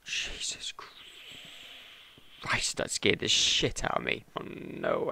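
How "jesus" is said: shaken breathy whisper. Real, not acted. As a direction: exhale it after a fright. After a scare.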